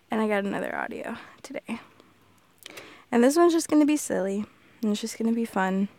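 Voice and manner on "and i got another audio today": soft unvoiced aside; whisper. Real, not acted. Creator softly telling listeners about the new audio, whispered. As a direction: whisper it like a little secret.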